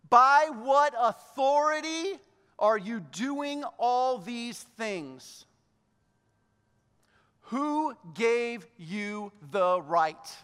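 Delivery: very slow, declamatory challenge; loud opening, then hammer out each word; demanding. Acted. Crowd of priests confronting Jesus in the temple, demanding. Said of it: "coming in and demanding of Jesus".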